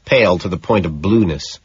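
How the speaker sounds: usual speaking voice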